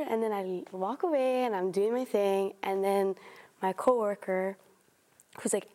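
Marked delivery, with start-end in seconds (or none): none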